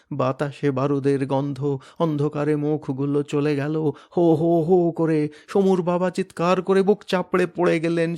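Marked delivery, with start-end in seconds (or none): 4.2-4.9 high, loud grieving howl; father's broken howl; anguished wail. Acted. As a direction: ho ho ho of grief. Father wailing.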